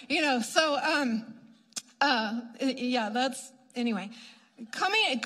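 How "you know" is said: ordinary talk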